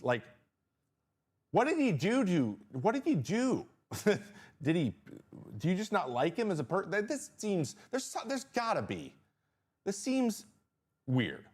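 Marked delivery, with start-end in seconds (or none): 1.5-11.4 much higher, incredulous and groping; can't believe what he's hearing; baffled disbelief. Real, not acted. Streamer baffled by the host mocking the accent, high disbelief. Reacts to the barf bag remark.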